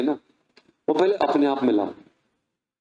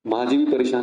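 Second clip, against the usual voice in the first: usual speaking voice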